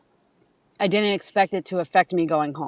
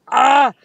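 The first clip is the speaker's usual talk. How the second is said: loud, higher mock cry of dismay; mock "ah" yell; 6 semitones higher, 7 dB louder than usual. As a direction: let out a short, hammed-up "ah". Mimics own frazzled reaction.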